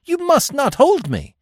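high, loud protest; distressed, forceful objection; objecting. A narrator's reading. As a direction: insist at full pitch on being released. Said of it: "she objected".

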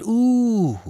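long, high-pitched ooh of wonder; awed crowd. A narrator's reading. Crowd watching the dragon appear, awed ooh. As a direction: draw out a high, admiring ooh. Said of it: "and everybody said".

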